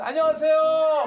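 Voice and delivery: loud, high, drawn-out greeting; put-on extrovert. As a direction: belt out a hammed-up on-camera hello. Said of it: "외향적인 척을 하는 거죠".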